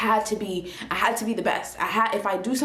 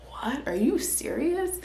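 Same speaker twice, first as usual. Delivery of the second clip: pitched up in disbelief, dragging out the second question; shocked disbelief; 6 semitones higher, slower than usual. Retold or imitated. Realizing her jealousy began in childhood, she asks in shocked disbelief. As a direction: caught off guard by the news. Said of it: "And I was like".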